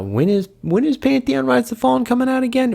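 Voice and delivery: high-pitched mock fan question; mock pestering in a squeaky-high voice; mimicking nagging fans. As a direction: put on a very high, silly voice for the question. Mimics fans' repeated question.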